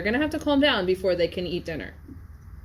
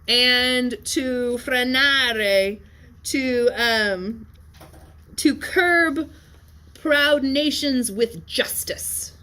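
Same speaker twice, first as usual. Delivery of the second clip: raised, slow and stately, the Latin rendered line by line; formal translation reading; 4 semitones higher, much slower than usual. Acted. Teacher reading the translation to class, slow and stately. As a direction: declaim it like an ancient speech to a queen.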